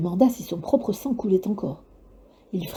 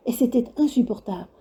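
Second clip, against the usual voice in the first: plain reading voice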